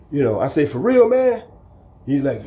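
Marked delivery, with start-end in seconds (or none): 0.7-1.4 incredulous. Retold or imitated. Incredulous challenge.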